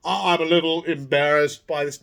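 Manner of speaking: mock-bashful celebrity act; affected, falsely humble impression; false modesty. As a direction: put on a show of being embarrassed. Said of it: "false modesty".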